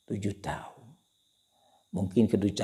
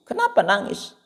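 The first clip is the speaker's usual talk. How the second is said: 1 worried mother; 12 semitones higher, 5 dB louder than usual. Acted. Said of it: "mother asks son".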